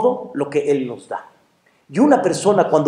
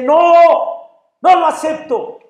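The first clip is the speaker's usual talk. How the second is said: high, loud cry of protest; defiant refusal; 10 semitones higher, 4 dB louder than usual. Acted. Man refusing the angel's decision, crying out. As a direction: refuse the verdict outright.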